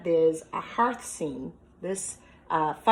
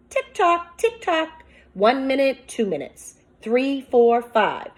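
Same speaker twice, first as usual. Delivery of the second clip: higher, louder, building suspense; suspenseful counting; 6 semitones higher, 5 dB louder than usual. Acted. Teacher reading the clock ticking to the class, higher and suspenseful. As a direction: count the minutes as if you can't wait.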